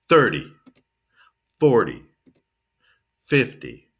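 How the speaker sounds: "thirty, forty, fifty" with loud first syllable, weak "dee" ending; stress on first syllable. Shown as a demonstration. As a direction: punch the start of "thirty, forty, fifty" and let it drop. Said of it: "Stress the first syllable and then finish kind of weak".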